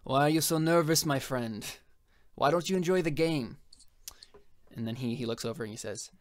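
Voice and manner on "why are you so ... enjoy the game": lightly voiced Haitian boss, higher and louder, needling. GM voicing the Haitian teasing his nervous partner, mock-jovial.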